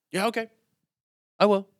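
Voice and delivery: mock eager kid, quick and high; imitating eager kid. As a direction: chirp it like an eager young boy. Imitating kid's eager reply.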